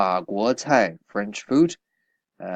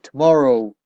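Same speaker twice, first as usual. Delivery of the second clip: "Tomorrow" said louder and higher, then falling; emphasis on fronted word; 5 semitones higher, 10 dB louder than usual. Shown as a demonstration. Teacher showing the fronted word, stressing "Tomorrow" loudly. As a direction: hit "Tomorrow" hard to show it comes first. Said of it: "We emphasize it".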